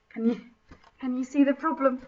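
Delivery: ordinary talk, nothing marked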